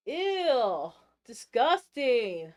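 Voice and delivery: loud, drawn-out disgust; hammed-up, slow "ew"; mock disgust. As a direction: stretch out each word in exaggerated revulsion. Mock disgust at the video's maker.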